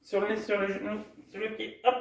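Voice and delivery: high-pitched, hurried list; racing. Shown as a demonstration. Coach racing children down to the feet, racing. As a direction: tumble quickly through the words. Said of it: "Encore plus vite".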